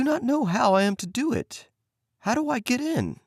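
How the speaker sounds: put-on high, naive child's voice; innocent girl. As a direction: play dumb sweetly. Gretel playing innocent.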